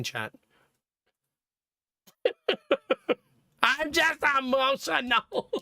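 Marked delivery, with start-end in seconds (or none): 3.6-5.5 high, slow hammed-up wail; mock weeping. Streamer voicing very emotional Mei Ling, mock weeping wail. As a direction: blubber it out, stretching the words. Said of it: "Mei Ling is very emotional".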